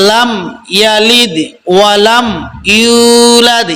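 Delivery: ইউলাদ with ইউ stretched one alif; tajweed recitation. Shown as a demonstration. Teacher recites the correct verse, measured chant. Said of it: "এখন থেকে আমরা পড়বো".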